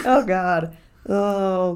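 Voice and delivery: ordinary talk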